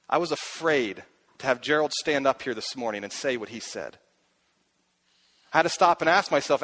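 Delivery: usual speaking voice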